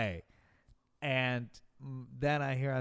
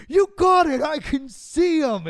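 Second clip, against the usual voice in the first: very loud, high imitated cheer; high mock holler, last words stretched; retold shouted encouragement; 12 semitones higher, 12 dB louder, slower than usual. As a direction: act out yelling to a runner alongside. Acts runner cheering him on.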